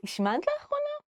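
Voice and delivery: very high, sugary השמנת לאחרונה? asked as if harmless; mock innocent question; sweet innocent tone. Shown as a demonstration. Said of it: "they never say it in a mean way".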